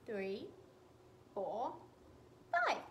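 usual speaking voice

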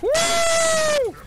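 high, loud triumphant shout; excited whoop. Real, not acted. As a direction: whoop at the top of your lungs. Celebrating in game.

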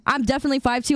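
ordinary delivery

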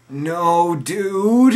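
high, loud dismayed no; shocked cry. Retold or imitated. Stoned narrator realizing he stepped on a snail. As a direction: gasp out in horror.

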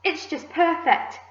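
high, delighted exclamation; excited cry. A narrator's reading. Aunt admiring the ripe peach, excited cry. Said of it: "she cried".

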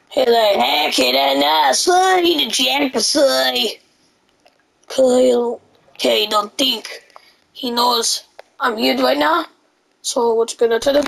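ordinary delivery

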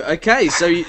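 normal talking voice

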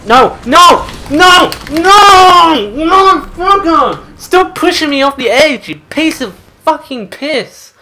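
high, loud outraged screaming; angry yelling. Real, not acted. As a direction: yell each no harder, then rant on. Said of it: "I'm yelling".

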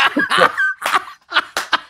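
loud, very high breathy giggle; imitated giggling fit. [name] taking the fans' picture, imitated giggling fit. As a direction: burst into a high, helpless mock giggle. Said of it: "[name] just giggling".

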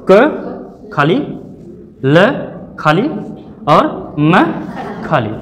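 each letter drawn out slowly, one at a time; teacher sounding out क, ल, म one by one; slow letter-by-letter spelling. Shown as a demonstration. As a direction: spell it out slowly for the class, pausing between letters. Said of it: "spelling कैसे करेंगे?".

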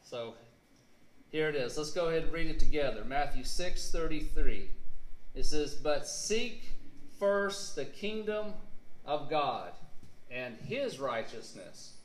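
usual speaking voice

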